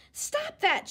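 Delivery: sharp high shout. A narrator's reading. Babysitter yelling at twirling kids, shout. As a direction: yell it to make them stop. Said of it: "Sheila shouted".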